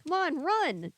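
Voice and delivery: put-on terrified boy crying out to run; shrill frightened cry. A narrator's reading. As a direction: scream at them to flee. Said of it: "his hoarse voice shrill and frightened".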